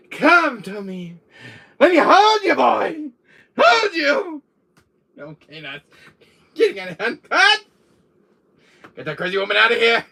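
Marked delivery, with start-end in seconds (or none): 0.1-4.4 high, loud, wailing demands; clingy villainess. Acted. Sister lunging to hold Chris, hammed-up shrieking plea. 6.5-10.0 loud, high order, quickening; alarmed shout. Acted. Calling cut on the crazy woman, alarmed shouting.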